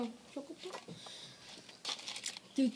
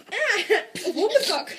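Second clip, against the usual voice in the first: laughing; 6 semitones higher, much slower than usual. Real, not acted. Laughs at the dog poop flavour.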